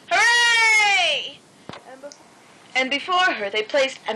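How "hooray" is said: high, loud hooray; party cheer. A narrator's reading. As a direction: cheer with the whole crowd, stretching it out. Party cheers after the birthday song.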